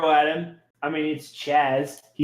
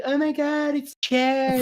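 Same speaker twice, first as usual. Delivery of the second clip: much higher gushing fangirl squeal; excited goblin girls; 13 semitones higher, slower than usual. Acted. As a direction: gush like a starstruck fan. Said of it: "all the goblin girls are like".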